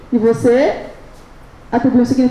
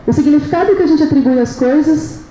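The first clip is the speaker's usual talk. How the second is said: ordinary delivery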